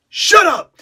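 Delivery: loud, high shout of shut up. Shown as a demonstration. Critic shows how a teammate should snap at Raven, shouting. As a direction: bark it to silence someone. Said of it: "The idea is, like, shut up!".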